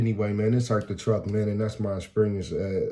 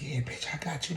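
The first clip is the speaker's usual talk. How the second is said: softer, higher winded threat; imitating out of breath; 6 semitones higher, 6 dB quieter than usual. Worn-out fighters still trading insults, mock breathlessness. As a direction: act too winded to keep fighting. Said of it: "They just out of breath now".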